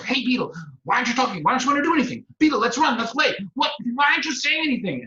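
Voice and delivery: high, loud, eager rat voice; pestering rat. Acted. Rat badgering the shy beetle, high excited voice. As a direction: bounce through it like a hyper rat.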